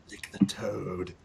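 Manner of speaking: low, slow, gruff order from the gnoll; low gruff gnoll command. Acted. Gnoll urging the blind adventurer to lick the toad, low and slow. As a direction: growl it down low, slowly insisting.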